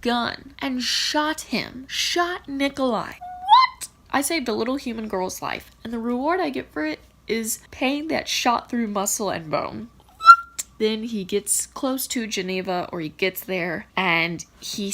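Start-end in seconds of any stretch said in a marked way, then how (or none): 3.1-3.9 high, loud disbelieving yelp; shocked what. Real, not acted. Reader reacts to the ending in disbelief, a shocked loud yelp. 10.0-10.7 a piercing, loud shriek of disbelief; outraged what. Real, not acted. Reader can't believe the reward is pain, a shrieking what.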